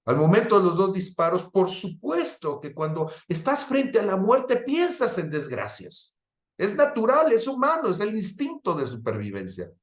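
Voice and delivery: voz aguda de predicador, con énfasis creciente; prédica enfática. Real, not acted. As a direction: predica con fervor, subiendo mucho el tono. (predicación exaltada, en tono alto)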